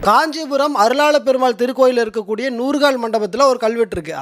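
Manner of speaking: usual speaking voice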